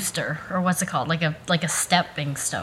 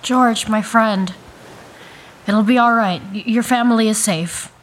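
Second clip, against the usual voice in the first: reassuring rescuer; 3 semitones higher, 6 dB louder than usual. Acted. Rescuer to his injured friend in the cabin, loud reassurance. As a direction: call out steady reassurance to a hurt friend.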